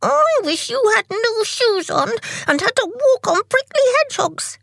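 high squealed, vengeful wish; very angry cry. A narrator's reading. Tick, laughed at, wishing prickles on Tock, very angry. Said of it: "he cried".

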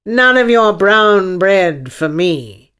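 slow, loud, lofty refusal; imperious unicorn voice; demanding unicorn. Acted. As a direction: refuse it grandly, stretching the words. Unicorn orders the king.

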